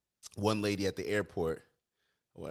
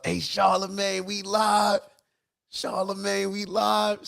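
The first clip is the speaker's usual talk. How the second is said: high, loud, slow excited yell; 13 semitones higher, 10 dB louder, slower than usual. Retold or imitated. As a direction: shout it down the phone hyped. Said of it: "Called up the Breakfast Club".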